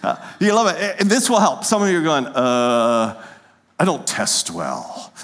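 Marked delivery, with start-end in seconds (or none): 2.3-5.1 low, slow, mock-reluctant grumble; reluctant test-taker. Congregation member asked to write an answer, low and reluctant. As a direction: play a hesitant student dragging out the excuse.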